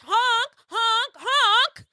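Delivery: high imitated car horn honks; honk imitation.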